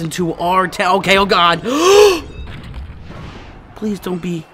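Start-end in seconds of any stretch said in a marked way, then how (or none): 1.6-3.4 sharp, high-pitched gasp; real gasp of alarm; startled gasp. Real, not acted. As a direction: gasp in sudden shock. Reacts to a play going wrong.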